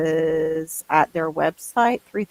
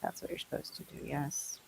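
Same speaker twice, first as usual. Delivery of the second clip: fast, soft whispered repeat; whispered aside; 2 semitones lower, 21 dB quieter than usual. Real, not acted. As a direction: whisper it quickly under your breath. Repeats the same line hushed.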